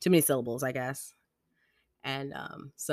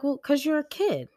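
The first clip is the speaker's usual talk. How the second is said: high, louder retold answer; parental retort; 5 dB louder than usual. Parents explaining the rule to kid, matter-of-fact retort. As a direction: toss back an obvious reason, pitched high.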